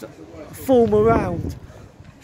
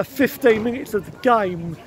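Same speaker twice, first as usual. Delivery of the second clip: usual speaking voice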